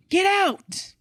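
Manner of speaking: high-pitched mock yell, no real volume; Gordon Ramsay. Reader voicing the Gordon Ramsay gif, mock yelling. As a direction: imitate a yell with a big jump in pitch. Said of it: "a gif of Gordon Ramsay yelling".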